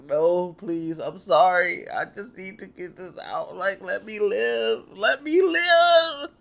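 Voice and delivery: retelling begging God for his life, mock wailing; begging the Lord. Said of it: "I'm begging the Lord".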